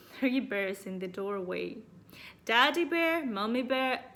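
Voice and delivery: plain reading voice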